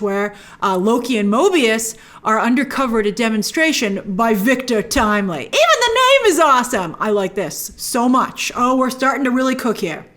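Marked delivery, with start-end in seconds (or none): 5.4-7.0 high, gushing fan enthusiasm; excited. Real, not acted. Fan reacting to Victor Timely's name, excited. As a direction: burst out in delighted approval.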